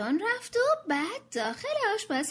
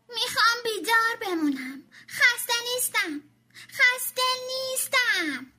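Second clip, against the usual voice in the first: high, loud wailing whine; crying shout; 8 semitones higher, 4 dB louder than usual. Acted. Tommy protesting bedtime to his mother, wailing. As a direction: wail it like a kid fighting bedtime. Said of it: "داد زد".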